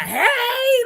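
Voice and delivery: high sing-song greeting; imitating daughter's hey. Daughter greets the old man, high and bright. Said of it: "She was like".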